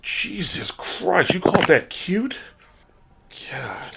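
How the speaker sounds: high, slow horrified gasp; shocked disbelief. Real, not acted. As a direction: drag it out in disbelief. Shocked at the creature.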